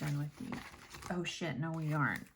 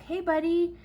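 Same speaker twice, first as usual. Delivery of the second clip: high, louder sing-song greeting to a critter; cheery hello; 12 semitones higher, 5 dB louder than usual. Retold or imitated. Mom checking the box greets the trapped squirrel, high and cheery. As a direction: coo hello at the little animal.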